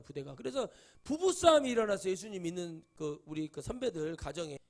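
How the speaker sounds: ordinary delivery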